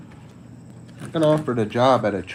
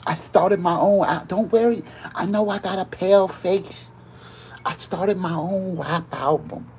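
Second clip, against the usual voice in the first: rapper voice; 8 semitones higher than usual. Acted. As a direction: hype up the rap album. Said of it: "you know how hard it is to do that face".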